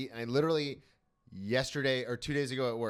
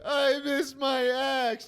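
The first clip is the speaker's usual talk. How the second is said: mock crying; 13 semitones higher, 7 dB louder, slower than usual. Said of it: "I'm there crying like".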